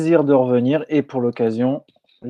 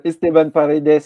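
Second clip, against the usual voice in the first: effortful Spanish pronunciation; Spanish accent; 4 semitones higher, 6 dB louder than usual. Real, not acted. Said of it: "t'as vu, j'ai fait un effort".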